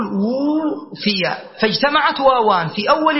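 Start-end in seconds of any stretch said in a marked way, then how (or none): 0.0-1.4 وفي with a very long, slow uu; drawn-out vowel. Shown as a demonstration. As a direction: hold the first vowel of وفي, then finish softly. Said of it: "سأقول".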